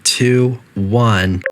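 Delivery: calm, even automated announcement voice; a lightly voiced facility intercom; facility announcer. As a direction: read it like a polite recorded PA system.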